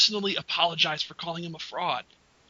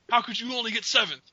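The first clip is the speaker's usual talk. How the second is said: normal talking voice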